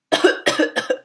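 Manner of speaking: a rough dry cough; coughing fit. A narrator's reading. Goldilocks coughing in the acidic river, harsh cough. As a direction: cough like a fish choking on bad water. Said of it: "Goldilocks started to cough".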